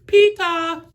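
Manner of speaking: high calling out Peter; What We Do in the Shadows Peter call. Retold or imitated. Reviewer imitating the film's vampire calling Peter, high call. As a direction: sing out the name high like the vampire flatmate. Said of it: "their version of Peter".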